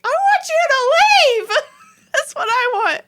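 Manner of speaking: very high, loud wail breaking into laughter; retold screaming demand. Wife demanding husband leave, retold screaming demand. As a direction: shriek it in hammed-up exasperation.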